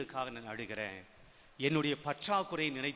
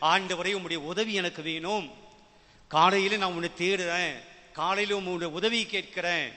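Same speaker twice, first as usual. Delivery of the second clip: louder, higher preaching voice; fervent prayer declaration; 4 semitones higher, 6 dB louder than usual. Acted. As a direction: declare dependence on God with full force. Preacher declares a morning prayer of faith. (உரத்த குரலில் விசுவாச அறிக்கை)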